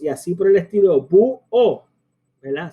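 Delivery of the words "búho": BÚHO split into bú-o, higher and louder; two syllables. Shown as a demonstration. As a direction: say búho in two punched beats. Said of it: "Son dos en vez de".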